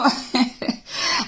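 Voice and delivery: soft, breathy little laugh; amused chuckle. Real, not acted. Reader breaking off from the question, chuckling softly. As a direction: chuckle quietly mid-question.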